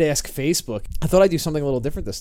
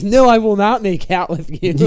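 loud, laughing, higher mock refusal; indignant mock shout; hammed-up quote of Billy Madison; Billy Madison impression; 5 semitones higher, 7 dB louder than usual. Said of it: "when he's like".

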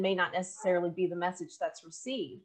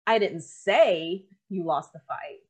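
emphasis landing on say; stress on SAY; 6 dB louder, slower than usual. Shown as a demonstration. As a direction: hit the word say hard and draw it out. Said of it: "based off of the inflection".

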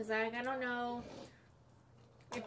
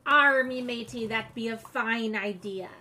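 pirate voice; 8 dB louder than usual. Pretend. Pirate talk to child.

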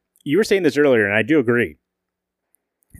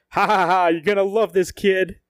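cackling, higher, manic glee; gleeful Batman; 7 semitones higher than usual. Retold or imitated. Batman to Robin as he revs the Batmobile, gleeful cackle. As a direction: relish it like a kid before a joyride. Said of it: "He goes".

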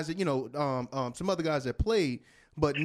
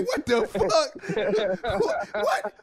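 slow, much higher, broken by laughter; laughing disbelief; 6 semitones higher, much slower than usual. Retold or imitated. Teammate recalling shock at De La's intro, laughing and slow. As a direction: laugh through the stunned question.

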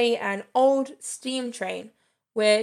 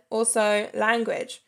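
ordinary talk

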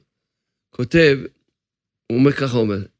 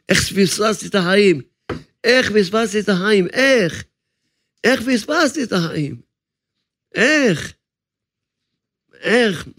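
rising anguished cry, higher; the ashamed soul; 4 semitones higher than usual. Acted. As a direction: ask how the life was missed, pleading. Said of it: "הבושה של הנשמה מרגישה". (נשמה מתביישת זועקת איך פספסתי, בקול גבוה)

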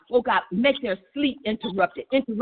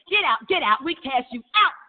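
usual speaking voice

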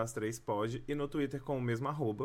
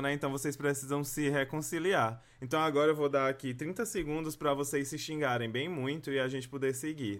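normal talking voice

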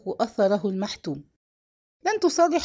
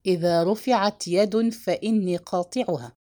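plain reading voice

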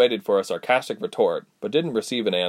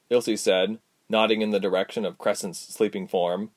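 usual speaking voice

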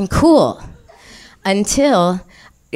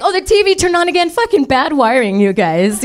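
denial; 6 semitones higher than usual. Retold or imitated. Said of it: "I'm like".

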